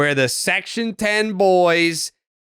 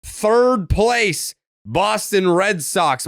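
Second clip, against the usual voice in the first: high, fast hyped podcast intro; show intro announcer; 5 semitones higher than usual. Acted. Host welcoming listeners to the episode, hyped announcer. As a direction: belt out the welcome at pace.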